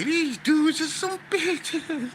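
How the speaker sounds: high, slow, laughing scorn; laughing at the cowards; scornful quote. Retold or imitated. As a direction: sneer it out through a laugh. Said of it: "I say".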